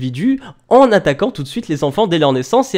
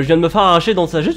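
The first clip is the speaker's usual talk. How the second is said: normal talking voice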